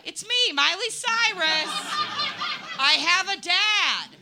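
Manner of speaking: high, loud, drawn-out mock star; hammed-up pop-star impostor. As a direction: proclaim it in a put-on high voice. Pretending to be [name].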